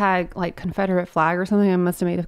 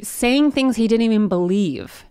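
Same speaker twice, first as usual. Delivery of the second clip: normal talking voice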